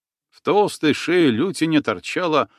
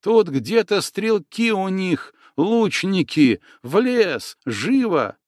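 urgent Tatar man; 7 semitones higher, slower than usual. Acted. Tatar urges flight.

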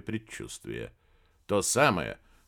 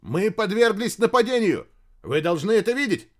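high, loud urgent report; alarmed cry; 8 semitones higher, 6 dB louder than usual. A narrator's reading. Radio operator reporting an attack, crying out. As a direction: raise the alarm to a superior. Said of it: "закричал вбежавший в палатку радист".

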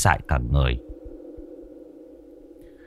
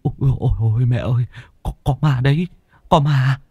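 higher, whimpering breathless plea; panting whimper; 8 semitones higher than usual. A narrator's reading. Frightened son whimpering to parents, higher and breathless. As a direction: whine to the parents to come and see. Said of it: "Phùng thở hổn hển mếu máo".

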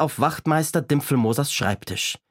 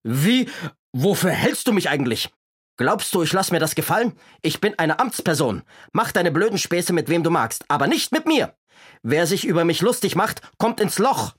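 fit of rage; 6 semitones higher than usual. Acted. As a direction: rant at the boy, fuming over your dignity. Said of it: "bekam einen Wutanfall".

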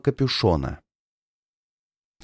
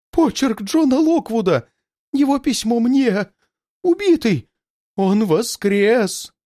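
much higher, frantic horrified cries; panicked terror; 10 semitones higher than usual. Acted. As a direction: babble in shock at a dead man's return. Villain panics at dead man's handwriting.

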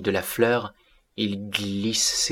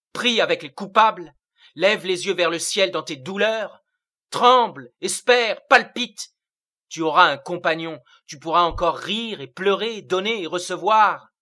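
building higher and higher, fervent; rising exhortation; 7 semitones higher, 3 dB louder than usual. A narrator's reading. Séraphitus urges Mina to live earthly life, climbing fervently. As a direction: climb in pitch line by line.

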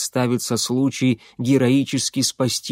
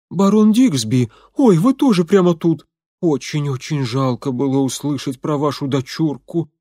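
put-on Irish accent; 3 dB louder than usual. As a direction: gush in a strong Irish accent. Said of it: "начала она с сильным ирландским акцентом".

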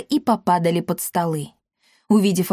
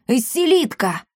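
high-pitched accusing cry; startled outcry, falling at the end; alarmed yell; 6 semitones higher than usual. A narrator's reading. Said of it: "завопил он".

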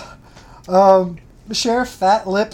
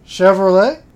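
southern accent; 8 dB louder than usual. Shown as a demonstration. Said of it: "I had to say it with a southern accent".

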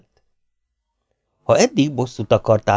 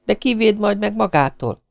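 high-pitched plaint; complaining; 12 semitones higher than usual. A narrator's reading. Maisie asking who will protect her from him, plaintively. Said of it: "panaszkodott Maisie".

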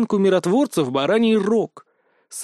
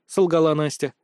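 ordinary delivery

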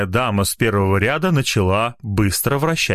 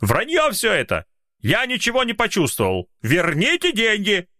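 angry heckler; 12 semitones higher than usual. Acted.

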